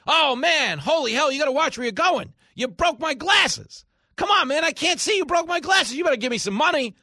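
high, loud fake indignation; mock con man outrage. Host playing a Times Square con man yelling at a tourist, high and loud. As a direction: play the scammer blaming a tourist.

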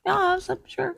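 high, quiet mock reply in a put-on voice; mocking friend. Host mocking her co-host's hummus story, high and quiet. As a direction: sneer it back in a thin voice.

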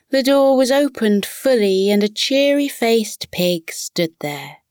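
ordinary delivery, nothing marked

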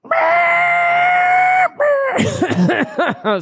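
loud high-pitched engine whine; race car noise. Shown as a demonstration. Showing friends a race car sound, loud high engine noise. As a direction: imitate a racing car screaming past. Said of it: "make the sound of a, of a race car".

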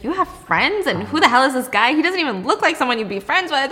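high, incredulous nosy caretaker; mocking caretaker. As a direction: sneer at the strange friend. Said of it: "She's like".

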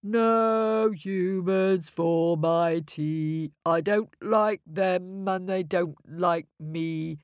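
slow, mournful sing-song; sad moan. Acted. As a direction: moan it like a sulking giant. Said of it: "moaned sadly to himself".

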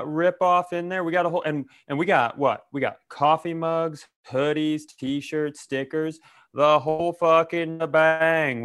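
ordinary talk, nothing marked

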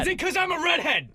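very high put-on indignation; mock offended. Co-host playing hurt over cat question, mock offended. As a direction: squeal it as if affronted.

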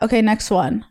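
usual speaking voice

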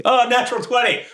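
high, laughing whoop of joy; excited laughing. Real, not acted. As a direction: squeal with delight. Rolls a natural twenty.

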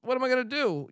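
high, puzzled mock voice; clueless fan. Fan with broken glasses wondering what to do, high and puzzled. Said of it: "you're like".

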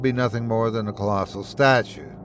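usual speaking voice

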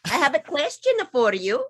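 a hint of a put-on Italian accent. [name] greets host Jesus, light Italian accent. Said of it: "And in that accent".

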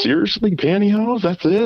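high mock incredulous girl; imitating her disbelief; girl's voice. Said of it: "It's like".

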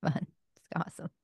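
breathy hushed whisper of awe. Real, not acted. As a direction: whisper it under your breath, impressed. Aside after hearing the quote.

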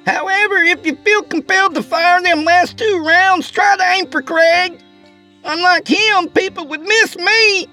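very high, sassy woman's call; shouting to the cabin. A narrator's reading. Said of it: "Lorna shouted in the cabin's direction again".

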